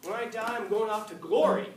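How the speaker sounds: a lightly played devout man; religious believer. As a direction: sing it out like a joyful believer. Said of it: "somebody who's particularly religious".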